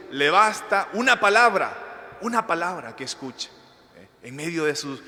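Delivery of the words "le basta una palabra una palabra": preacher at full fervour; impassioned preaching. Acted. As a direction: lean into every word for the faithful. Preaching climax.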